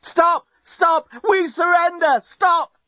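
very high, frantic shouts; bellowing. A narrator's reading. Journalist begging the enemy fleet through a microphone, bellowing. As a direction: shout each plea as a separate burst. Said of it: "bellowed into it".

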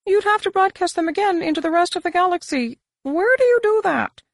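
high alien voice. Acted. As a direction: voice him high and probing.

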